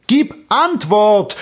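slow, loud, high demand; insistent shout. A narrator's reading. Prince demanding an answer from the echo, insistent shout. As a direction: demand it at full voice. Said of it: "sagte er noch einmal".

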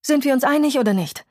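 high, impatient demand; irritated. A narrator's reading. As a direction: press for an answer, short of patience. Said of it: "sagte sie gereizt".

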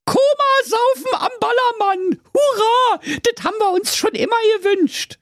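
put-on high squeaky kid goats cheering; cheering little goats. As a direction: whoop like tiny excited goats. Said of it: "freuten sich ... sehr und riefen".

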